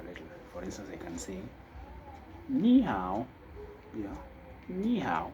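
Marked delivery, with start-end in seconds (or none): none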